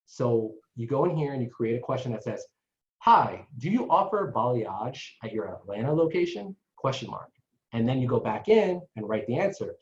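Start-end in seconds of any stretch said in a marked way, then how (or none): none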